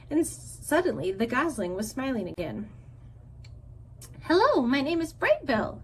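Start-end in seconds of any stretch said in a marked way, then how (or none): 4.2-5.8 light shout. A narrator's reading. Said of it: "he shouted over the waves".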